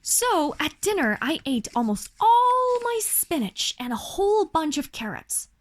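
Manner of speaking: plain reading voice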